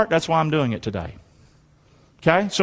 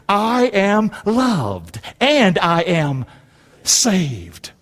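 slow declaration; much slower than usual. Shown as a demonstration. Preacher gives congregation extra help, slow declaration. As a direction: lead it slowly, word by word.

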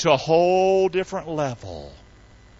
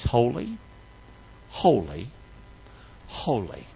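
low, spaced-out words; solemn answer; 4 semitones lower, 6 dB quieter, slower than usual. Real, not acted. Preacher answering how God could judge, low and solemn. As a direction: let each "holy" sink lower, with pauses.

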